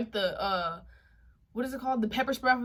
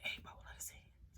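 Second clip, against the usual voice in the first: whisper; 22 dB quieter than usual. Retold or imitated. Sneaking in, asking through door.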